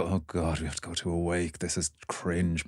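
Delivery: put-on quiet, mumbled bored teenager; bored teen groan. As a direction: sigh it out like a reluctant teen. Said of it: "the younger generation probably kind of go".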